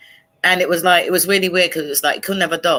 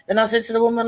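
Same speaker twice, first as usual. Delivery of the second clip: normal talking voice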